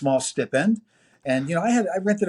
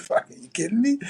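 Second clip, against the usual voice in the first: higher, amazed outburst; higher, amazed reaction to the job offer; stunned disbelief; 11 semitones higher than usual. Retold or imitated. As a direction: replay his shocked delight.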